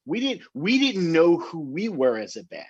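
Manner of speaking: ordinary talk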